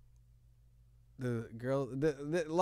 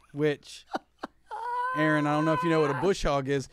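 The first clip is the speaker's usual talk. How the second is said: normal talking voice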